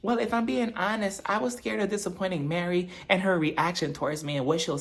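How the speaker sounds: put-on high, soft little-girl voice; timid Whitney. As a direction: speak small and scared like a child. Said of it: "she's like".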